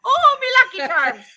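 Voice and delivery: high, loud mock Irish; Irish accent. Reminding friend of the catchphrase, high mock Irish accent. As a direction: cry it out in cartoonish Irish. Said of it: "like, an Irish accent".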